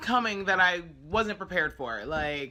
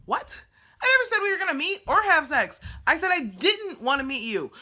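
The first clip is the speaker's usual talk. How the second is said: much higher and louder, outraged; indignant outburst; 11 semitones higher, 6 dB louder than usual. Real, not acted. Retelling creepy texts to viewers, indignant and loud. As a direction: protest hotly that you never said it.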